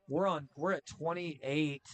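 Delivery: ordinary talk, nothing marked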